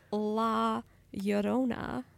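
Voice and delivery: slow Spanish pronunciation of La Llorona; Spanish accent. Shown as a demonstration. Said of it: "I said it with a good accent there".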